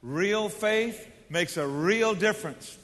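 slow, each word spoken together; slow call-and-response recital; slow congregational recital. Shown as a demonstration. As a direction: lead the room through it slowly. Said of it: "Say it with me, everybody".

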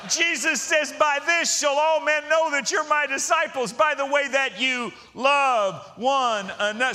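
high, loud scripture proclamation; full-force preaching. Real, not acted. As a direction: proclaim the verse to the whole congregation, stretching key words.

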